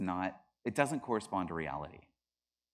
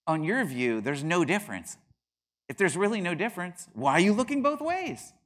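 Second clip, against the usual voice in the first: raised voice, pitched high, pressing the point; heated challenge; 8 semitones higher, 7 dB louder than usual. Retold or imitated. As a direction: drive the question home, louder and higher. Pressing his friend in argument.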